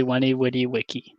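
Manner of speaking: "Weni Witty Wiki" with a W sound for every V. Shown as a demonstration. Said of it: "you pronounce it with W".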